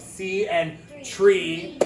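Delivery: See and tree drawn out very slowly; slow model words. Shown as a demonstration. Teacher sounds out example words for students, very slow. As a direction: stretch each word so the kids hear the E. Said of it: "teacher models example words".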